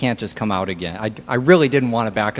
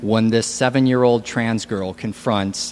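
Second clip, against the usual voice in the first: ordinary delivery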